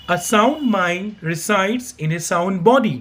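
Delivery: slow, careful teacher's model reading; slow model sentence. Shown as a demonstration. Teacher models pronunciation slowly for learners. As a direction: say each word slowly so students can copy. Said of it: "इसका pronunciation आप यहाँ से भी सीख सकते हैं".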